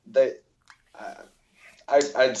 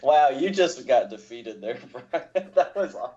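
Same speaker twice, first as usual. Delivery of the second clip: laughing tease; 4 semitones higher than usual. Real, not acted. Teasing a defeated friend.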